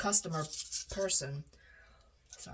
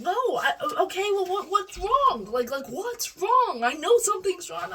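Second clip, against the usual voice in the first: worried mom; 12 semitones higher, 7 dB louder than usual. Retold or imitated. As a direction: plead worriedly, pitched up, slowing.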